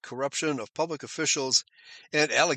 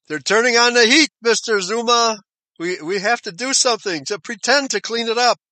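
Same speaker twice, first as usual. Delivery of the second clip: higher, louder mock voice of panicking party leaders; mock party bosses; 8 semitones higher, 7 dB louder than usual. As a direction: ham up the nervous officials turning on Zuma. Voicing ANC leaders pressuring Zuma.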